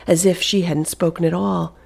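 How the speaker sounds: ordinary delivery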